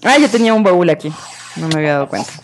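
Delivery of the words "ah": ordinary talk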